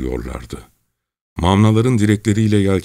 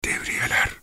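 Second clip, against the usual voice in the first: barely audible, voiceless whisper; 6 dB quieter than usual. A narrator's reading. Çelkaş warns of patrols in the dark, whispered. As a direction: whisper the warning very softly. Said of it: "ancak duyulabilen bir sesle".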